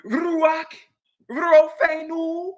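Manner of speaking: "Ruach, Rofenu" high and loud with an exaggerated rolled R and slow "Rofenu"; mocking Hebrew. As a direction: parody the showy Hebrew R's. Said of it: "you're rolling your reshes now".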